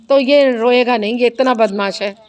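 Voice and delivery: ordinary delivery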